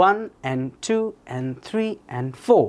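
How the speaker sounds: slow bouncing count, "and" dropped low to "en"; rhythmic sentence stress. Shown as a demonstration. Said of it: "রিদমের মতো কাজ করে". (তালে তালে, সংখ্যায় জোর, "and" নিচু "এন")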